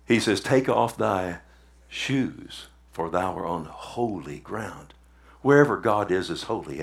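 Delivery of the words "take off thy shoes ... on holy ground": low, slow, soft voice of God from the bush. Acted. God telling Moses to take off his shoes, low and slow.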